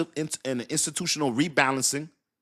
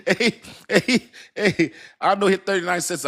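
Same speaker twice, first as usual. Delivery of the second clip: excited; 5 semitones higher, 4 dB louder than usual. Real, not acted. Hyped about a price crash.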